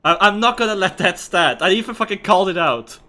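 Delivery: loud, angry rant at a teammate. Real, not acted. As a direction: shout it out, fed up and furious. Player furious at teammate's mistake.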